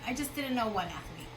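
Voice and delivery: ordinary talk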